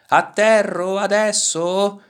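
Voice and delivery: high, loud, stretched-out cry; shouted question. A narrator's reading. Turtle plummeting toward the animals, high shouted question. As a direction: holler it slowly while plunging down. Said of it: "gridò".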